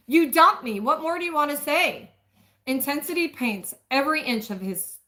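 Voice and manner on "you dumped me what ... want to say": loud and much higher, pained; hurt angry outburst. Acted. Woman lashes out at the man who dumped her, hurt and loud. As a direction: throw the hurt right back at him.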